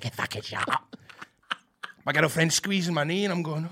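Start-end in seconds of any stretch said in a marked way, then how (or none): 0.0-1.0 angry whisper back over the shoulder; retold whisper. Whispering back at the man behind him, angry whisper. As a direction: hiss it under your breath.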